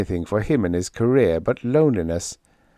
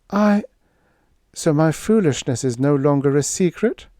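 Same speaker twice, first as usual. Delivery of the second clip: a sudden high catch, then higher; startled Lila; 6 semitones higher than usual. A narrator's reading. Lila, surprised Dale knows her secret, answers flustered. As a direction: caught off guard, half embarrassed.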